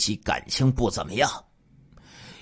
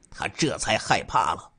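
ordinary delivery

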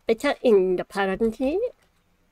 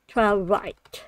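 ordinary talk, nothing marked

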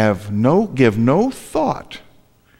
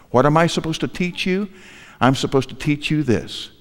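ordinary talk, nothing marked

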